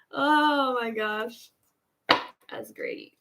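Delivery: normal talking voice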